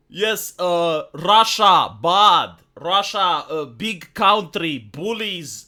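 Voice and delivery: hammed-up Eastern European accent in halting short phrases; mock Slavic accent, punching key words; Kamala Harris. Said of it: "immediately loading accent".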